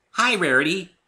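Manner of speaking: high, loud bright chirp. Acted. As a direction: chirp a cheery hello. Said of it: "chirped Pinky".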